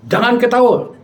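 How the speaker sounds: high mock-cross order; retold scolding, pitched well up; angry. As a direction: imitate the affronted spirit forbidding it. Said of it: "dia marah".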